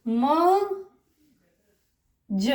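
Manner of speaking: "म" drawn long, then "ज" with no r; stretched first vowel. Shown as a demonstration. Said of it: "थोड़ा सा खींच देंगे".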